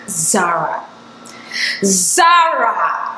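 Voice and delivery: drawn-out imitation of a bossy name call; mock summoning call. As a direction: stretch out "Zara" like mockingly calling someone. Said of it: "You don't have to go all Zara".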